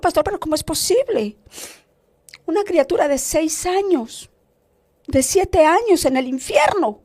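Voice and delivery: pained, incredulous appeal to a pastor; retold tearful plea. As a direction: plead in dismay, as if through tears. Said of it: "yo lloraba y yo le decía al pastor".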